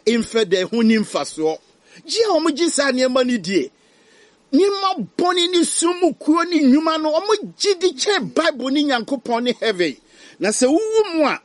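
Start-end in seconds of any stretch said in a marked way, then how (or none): 2.0-11.4 high, loud fiery preaching; preaching at full force. Acted. Preacher condemns unbelievers, loud and fervent. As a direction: hammer the warning home.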